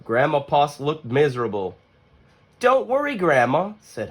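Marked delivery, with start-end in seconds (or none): 2.6-3.7 put-on high small child voice, reassuring; little Hush comforting. Hush comforts sad Grandma, high child voice. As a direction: soothe her in a little voice.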